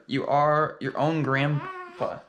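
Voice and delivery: slow, drawn-out punchline; dramatic slow reveal. Acted. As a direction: land it slowly for effect.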